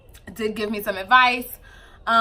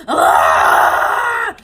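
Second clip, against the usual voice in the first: full-blast mock screaming; loud, sustained scream imitating a baby; imitated baby scream; 10 dB louder than usual. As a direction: scream it out like a shrieking infant. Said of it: "she starts screaming".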